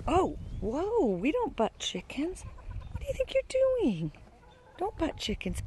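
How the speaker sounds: high-pitched telling-off of a pet; mild scolding. Real, not acted. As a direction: chide an animal in disbelief. Scolding an animal for butting.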